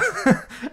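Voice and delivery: quick amused chuckle; short laugh. Real, not acted. Laughing while calling the movie silly. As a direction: laugh at your own pick.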